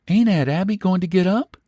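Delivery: put-on high, lightly surprised girlish voice; spoiled young woman puzzled by the empty kitchen; innocent young woman. A narrator's reading. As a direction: ask innocently. Luella asking.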